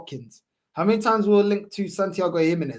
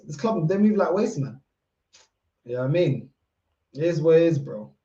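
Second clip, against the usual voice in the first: usual speaking voice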